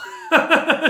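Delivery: high, loud burst of laughter; spontaneous amused cackle; real laugh. Real, not acted. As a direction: crack up at bad acting. Laughing at terrible acting.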